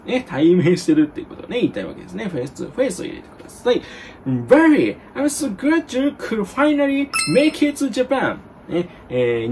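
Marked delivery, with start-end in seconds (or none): 4.3-8.4 high, gushing, drawn-out mock joy; hammed-up excitement. Shown as a demonstration. Teacher playing a classmate welcoming a friend to Japan, theatrically thrilled. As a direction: overplay being thrilled to see a friend. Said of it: "感情込めないといけませんか？".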